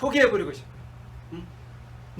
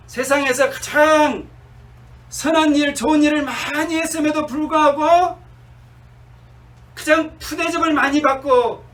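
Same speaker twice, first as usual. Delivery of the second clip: rising sermon appeal; 5 semitones higher, 4 dB louder than usual. Acted. Preaching at full force.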